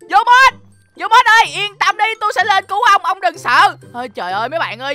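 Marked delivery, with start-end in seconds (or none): 0.1-3.8 high, loud reassuring shouts; shouting up. Acted. Calling up to captured friend, loud and reassuring. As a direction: shout up to the island.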